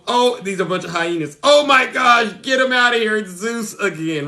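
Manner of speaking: mocking the live crowd. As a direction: mimic the TikTok crowd spotting Zeus. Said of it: "everybody in the live goes".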